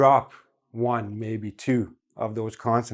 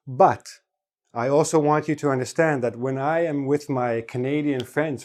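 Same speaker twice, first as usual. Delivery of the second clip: ordinary talk, nothing marked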